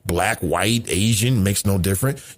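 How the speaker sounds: ordinary talk, nothing marked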